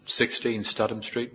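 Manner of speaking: ordinary talk, nothing marked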